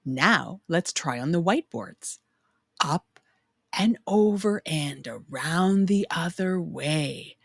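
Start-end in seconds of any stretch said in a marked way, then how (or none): none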